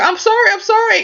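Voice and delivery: high-pitched mock crying plea; retold sobby pleading; imitated tearful apology. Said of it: "she was crying".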